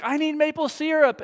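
a hint of a fussy toddler; whiny daughter. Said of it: "She's like".

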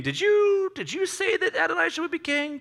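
very high, tentative mock query. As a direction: squeak the question out carefully. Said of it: "he comes in a little bit softer".

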